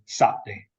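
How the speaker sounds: "Saturday" cut to two syllables with a glottal stop; broadest local form. Shown as a demonstration. Lecturer demonstrating broad Manchester speech, glottal stop. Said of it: "So it has a glottal stop".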